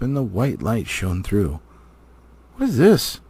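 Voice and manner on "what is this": surprised. Acted. Elrond surprised by hidden letters.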